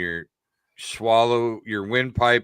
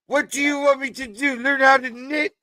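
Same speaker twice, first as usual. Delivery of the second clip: high, whiny protest; exasperated husband; 13 semitones higher, 4 dB louder than usual. Retold or imitated. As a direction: protest in a high, put-upon whine. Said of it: "in reply to the wife's quote".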